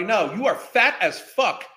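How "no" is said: normal talking voice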